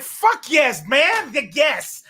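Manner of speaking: high, loud, emphatic retort; still mad. Retold or imitated. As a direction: hit every yes with indignation. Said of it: "Are you for real still mad".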